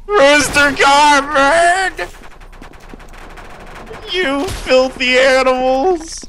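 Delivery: high, long-drawn war cry; hammed-up battle cry. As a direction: stretch each word out while charging. Mock battle cries while shooting.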